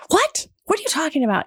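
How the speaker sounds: retelling her roommate defending her, shocked "What?" jumping high; a friend's outraged disbelief, replayed; indignant disbelief. Roommate confronting the rude guy, indignant and incredulous. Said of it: "she's like".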